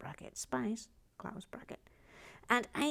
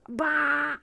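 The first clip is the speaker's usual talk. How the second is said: loud, exasperated scoff; grumpy bah; 5 semitones higher, 9 dB louder than usual. Real, not acted. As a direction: scoff at the prices. Grumbles about retired prices.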